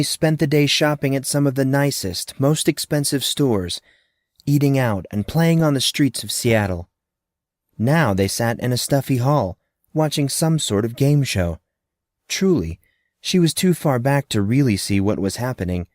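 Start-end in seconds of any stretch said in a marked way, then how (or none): none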